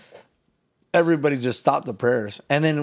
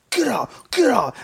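shouted command; 11 semitones higher than usual. Retold or imitated. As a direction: drive him out with a yell. Commanding the demon out.

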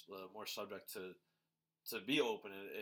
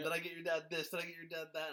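imitated boast, pitched up; mock bragging; 7 semitones higher, 4 dB louder, faster than usual. Speaker quotes the husband listing gifts to her family, bragging. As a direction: rattle off his favours as a raised-voice brag. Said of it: "is bragging right now".

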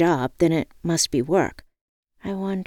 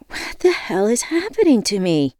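higher, alarmed outburst; panicked cry; 6 semitones higher, 4 dB louder than usual. A narrator's reading. Woman mid panic attack asks herself, panicked.

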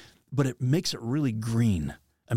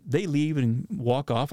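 ordinary talk, nothing marked

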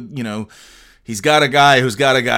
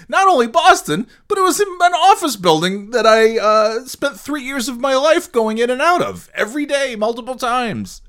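much higher, animated and eager; real excited telling; 13 semitones higher, 3 dB louder than usual. Real, not acted. Telling viewers about his old office, excited and high. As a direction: rush it out, thrilled by the memory.

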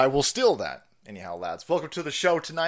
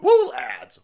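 cheer; 7 dB louder than usual. Real, not acted. Excited cheer to viewers.

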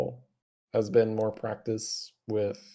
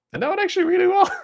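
high laugh breaking in; 6 dB louder, much faster than usual. Real, not acted. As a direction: burst into a laugh. Laughs at own result.

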